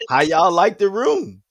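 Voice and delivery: mock New Orleans mama voice; New Orleans accent. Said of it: "In her New Orleans accent".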